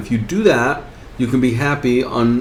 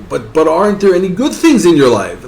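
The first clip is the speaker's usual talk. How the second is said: higher, stammering plea; acted pleading; 6 semitones higher, 3 dB louder, faster than usual. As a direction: plead with a desperate man, searching for hope. Bystander pleads with the jumper.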